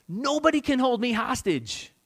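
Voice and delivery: ordinary delivery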